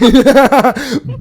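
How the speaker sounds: high, loud burst of laughing; loud cackle. Real, not acted. Host joking about holiday weight, loud high cackle. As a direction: crack up at your own phrase.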